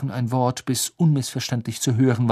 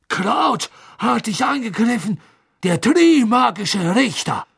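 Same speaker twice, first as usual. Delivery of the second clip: alarmed; 12 semitones higher, 3 dB louder than usual. A narrator's reading. Karkaroff shocked and alarmed.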